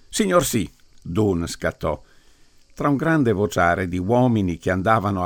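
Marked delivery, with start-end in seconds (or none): 0.1-0.7 high, crisp soldier reply; snappy yes sir. Acted. Dunn answering the priest's order, snappy soldier reply. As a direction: snap to it like a private.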